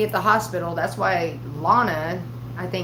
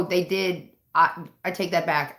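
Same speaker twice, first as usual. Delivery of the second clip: usual speaking voice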